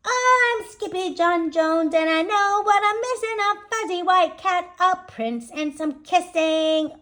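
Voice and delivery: put-on high, loud bragging child; boisterous kitty boy. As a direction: belt it out like a kid bouncing on a bed.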